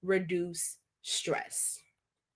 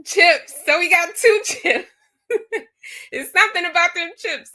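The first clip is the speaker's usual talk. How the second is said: excited; 9 semitones higher, 6 dB louder than usual. Real, not acted. Chips keep winning the game.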